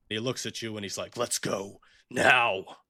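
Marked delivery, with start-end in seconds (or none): 1.2-2.8 slow, drawn-out threat; slow menacing father. Acted. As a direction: say it slowly like a cruel father. Father repeats order, same scene.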